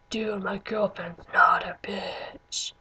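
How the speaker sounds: low, slow mock-tough guy voice; imitated defensive boyfriend. Friend tells off the tattoo guy, imitated low, slow defence. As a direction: draw it out low as a mock warning.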